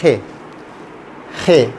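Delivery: "खे" with slight friction in the throat; light h-kh. Shown as a demonstration. Teacher repeating G before E, light h-kh. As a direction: keep the kh of "खे" light, near h. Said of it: "दरमियानी आवाज़ हल्का सा".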